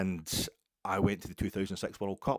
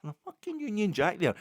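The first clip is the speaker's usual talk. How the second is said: much higher, louder, scandalised; indignant outrage; 11 semitones higher, 5 dB louder than usual. Retold or imitated. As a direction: spit it out in mock disgust. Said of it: "I got right on my high horse".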